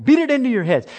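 mock forceful. Pastor joking to the youth he'd beat it in, mock loud. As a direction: pretend to hammer it home.

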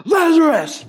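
high, loud, held call; attention-grabbing shout. Acted. Jesus calling over the talking crowd, shouting. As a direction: cut through the chatter of the crowd. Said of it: "to get everyone's attention".

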